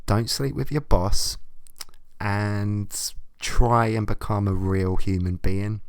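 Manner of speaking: normal talking voice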